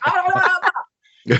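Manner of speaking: high, dropping babble of mock shock; high flustered gibberish, played for laughs; starstruck stammer. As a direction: imitate being lost for words, starstruck. Retold starstruck speechless reaction to Stone.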